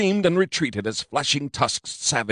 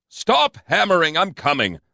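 higher, louder call through the door; shouted; 8 semitones higher, 3 dB louder than usual. A narrator's reading. Narrator yelling at the police pounding on the door, loud. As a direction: yell at the pounding to stop. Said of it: "I shouted".

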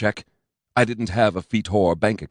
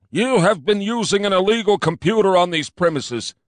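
fat cop; 10 semitones higher, 4 dB louder than usual. A narrator's reading. Fat cop accuses narrator.

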